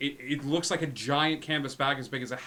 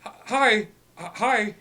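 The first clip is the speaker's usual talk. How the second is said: panicked; 9 semitones higher, 10 dB louder than usual. Acted. Wizard panics when grabbed.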